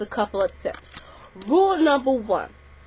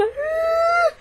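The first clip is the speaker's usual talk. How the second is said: mock yelp. Girl poked in eye.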